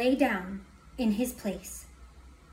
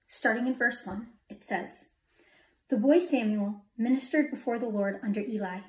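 usual speaking voice

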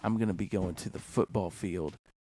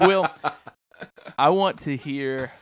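laughing excitedly; 4 semitones higher, 8 dB louder, much slower than usual. Real, not acted. Laughing as he brings up accents.

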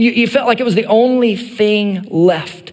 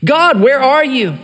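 higher, pleading cry to God; 5 semitones higher than usual. Retold or imitated. Psalmist begging God to show up, pleading. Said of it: "the psalmist crying out to God".

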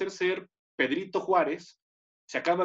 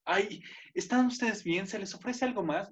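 mock polite hostess; 3 semitones higher, 5 dB quieter than usual. Bereaved mother offering guests more food, mock polite.

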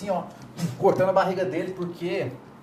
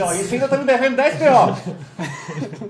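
drawled with a laugh, a bit higher and louder; cocky threat; 4 semitones higher, 7 dB louder, slower than usual. Retold or imitated. Retelling his threat to the thug, slow and laughing. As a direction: lean on him slowly, half laughing. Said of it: "Eu falei assim".

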